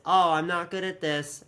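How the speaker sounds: usual speaking voice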